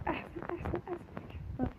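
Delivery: hushed, almost voiceless repeats; whispered. Real, not acted. Whispering praise of a hiding spot. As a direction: breathe it out, barely audible.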